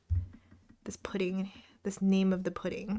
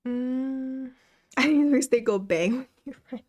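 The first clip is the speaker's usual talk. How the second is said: normal talking voice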